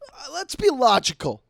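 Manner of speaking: high, loud put-on dad voice; imitating logical dad. Dad rejecting his son's conspiracy story, hammed-up objection. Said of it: "he's anti-conspiracy theorist".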